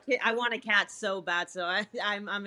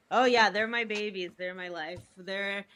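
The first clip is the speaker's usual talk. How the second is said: ordinary talk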